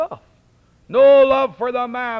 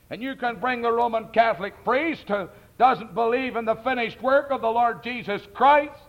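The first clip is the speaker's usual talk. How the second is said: ordinary talk, nothing marked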